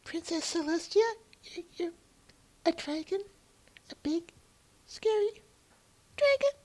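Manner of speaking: very high squeaky pony voice; helium voice. Acted. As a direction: squeak like a tiny nervous pony. Plays a pony character.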